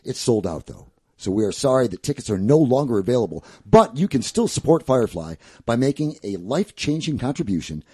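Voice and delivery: booming DJ promo read; radio voice. Shown as a demonstration. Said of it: "give me the radio voice for".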